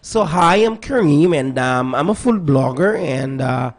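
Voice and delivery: put-on American accent for a food blogger; best American accent. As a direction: introduce yourself in an exaggerated American accent. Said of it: "I'll put on my best American accent".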